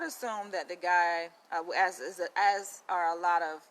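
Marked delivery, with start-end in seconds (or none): none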